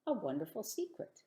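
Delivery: ordinary delivery, nothing marked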